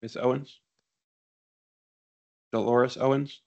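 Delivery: usual speaking voice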